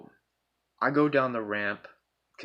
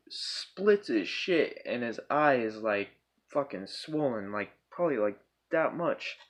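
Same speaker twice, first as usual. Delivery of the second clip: ordinary talk, nothing marked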